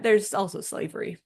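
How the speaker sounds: ordinary talk, nothing marked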